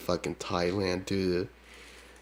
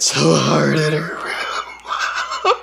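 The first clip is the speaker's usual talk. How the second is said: mock crying laugh; 8 semitones higher, 6 dB louder, much slower than usual. Mocking the woman's crying.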